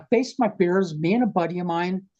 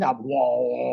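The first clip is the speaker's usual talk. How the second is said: low, drawn-out humming "whoa"; long, low buzzing drone; didgeridoo drone; 3 semitones lower than usual. Retold or imitated. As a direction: imitate a didgeridoo droning like a hive of bees. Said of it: "sounds like a hive of bees".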